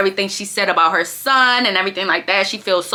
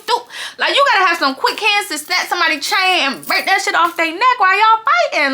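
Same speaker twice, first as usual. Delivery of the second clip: excited laugh; 9 semitones higher than usual. Real, not acted. Laughing, raised voice.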